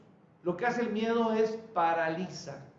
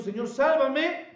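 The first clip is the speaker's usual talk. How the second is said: Peter crying out; 7 semitones higher, 5 dB louder, slower than usual. Retold or imitated. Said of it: "Dio voces diciendo".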